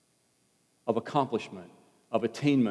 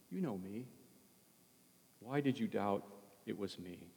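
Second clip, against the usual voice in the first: softer, tender questioning as Jesus; gentle Jesus; 8 dB quieter than usual. Acted. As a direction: speak to Peter like a patient, loving friend.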